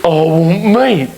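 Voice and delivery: high, drawn-out hammed-up dinosaur whine; whining dinosaur. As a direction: play a dinosaur begging, slow and high. Voicing the demanding pet dinosaur.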